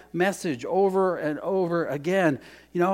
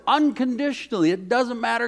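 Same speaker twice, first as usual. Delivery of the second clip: ordinary talk, nothing marked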